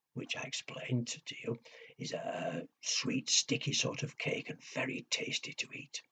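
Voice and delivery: soft whispered description of the cake; whispered aside. Acted. Storyteller whispering to the child what parkin is. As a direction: whisper it like a secret. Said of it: "whispered explanation aside".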